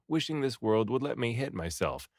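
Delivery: ordinary delivery, nothing marked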